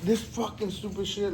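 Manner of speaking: high, contained retold cursing; mock pissed-off cussing. Retelling cussing after the toilet burst, mock pissed-off. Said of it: "like, I was pissed".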